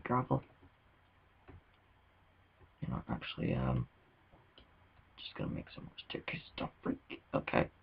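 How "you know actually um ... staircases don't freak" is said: hushed whisper to himself. Real, not acted. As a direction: whisper it under your breath. Quiet mining, muttering to self.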